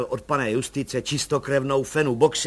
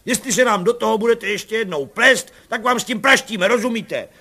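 loud, higher, scolding thunder; 7 semitones higher, 7 dB louder than usual. A narrator's reading. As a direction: roar at the meddling woman. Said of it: "začal hromovat pan Bartošek".

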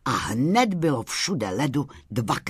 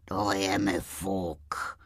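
low, slow, soft grumble; dismissive low old-walrus voice; grumpy walrus; 6 semitones lower, 6 dB quieter, slower than usual. A narrator's reading. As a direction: grumble it out slowly like a sullen walrus. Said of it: "mrzoutil se mrož".

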